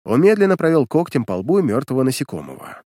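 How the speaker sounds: ordinary delivery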